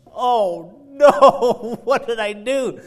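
retold panic. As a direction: relive the shock, half laughing. Retold panic after crash.

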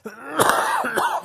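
harsh, loud cough; real cough breaking through; coughing fit. Real, not acted. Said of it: "Sorry about the coughing today".

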